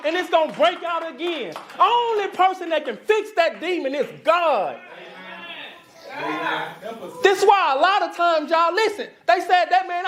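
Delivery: high-pitched, fired-up sermon delivery; impassioned preaching. Real, not acted. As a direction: preach it with urgency, voice lifted high.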